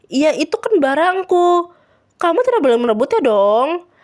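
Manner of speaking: acted exasperated objection; firm insistence. A narrator's reading. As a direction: insist on it forcefully. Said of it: "tegas Laura".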